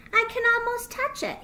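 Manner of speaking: high, quick, loud wonder; small child marvelling overhead; excited little fox. Acted. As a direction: blurt it with childlike eagerness. Little fox reaches for moon.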